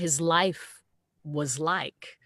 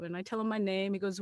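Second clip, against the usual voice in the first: ordinary talk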